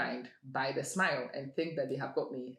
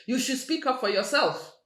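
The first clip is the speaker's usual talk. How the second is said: pushy adviser; 10 semitones higher, 9 dB louder than usual. Retold or imitated. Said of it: "people who are like".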